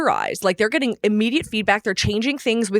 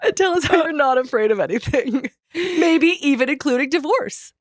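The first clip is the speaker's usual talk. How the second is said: laughing; 5 semitones higher than usual. Real, not acted. Laughing invitation to a celebrity.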